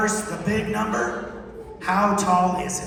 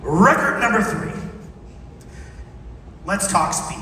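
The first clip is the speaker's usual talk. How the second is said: ordinary talk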